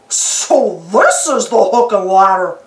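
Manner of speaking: slow, lightly dopey cartoon voice; Goofy voice. Goofy points out the modern engines: slow dopey voice. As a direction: drawl it like a proud goof. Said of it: "said Goofy".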